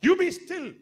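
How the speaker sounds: high, loud commanding shout; shouting at the storm. Acted. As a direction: order the storm to be still. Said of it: "He shouted at the storm".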